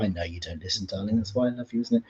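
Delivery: quiet affectionate jab at husband; soft teasing. Real, not acted. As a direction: drop right down, teasing darling.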